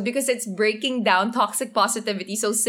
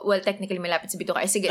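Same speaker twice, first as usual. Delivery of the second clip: ordinary talk, nothing marked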